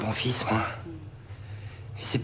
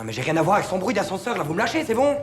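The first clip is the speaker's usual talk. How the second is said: rising, higher-pitched indignation; exasperated protest; 6 semitones higher than usual. Acted. Suspect denying the lift affair to police, exasperated. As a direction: protest innocence, fed up and climbing in pitch.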